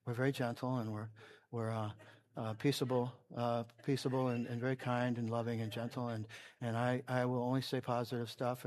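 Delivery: hushed, low, very gentle parody of a polite preacher; mock stoic. Preacher showing how he could be without yelling, mock stoic. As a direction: barely raise your voice, all meek and peaceable. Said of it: "be very stoic and say".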